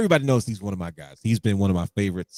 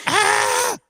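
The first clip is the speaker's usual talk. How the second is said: a sudden, very high excited squeal; 3 dB louder than usual. Retold or imitated. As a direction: let out a fan's squeal of joy. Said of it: "and I squealed".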